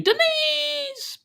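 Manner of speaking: high calling cry of her name; mock call across the room; calling out Denise. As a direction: sing out her name as if she's far off. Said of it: "Danny's going".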